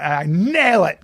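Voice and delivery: ordinary talk, nothing marked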